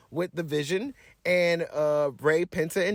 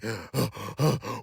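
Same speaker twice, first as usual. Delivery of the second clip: Jey Uso gasp; 9 semitones lower, 5 dB quieter than usual. Retold or imitated. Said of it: "some out of breath yeeting".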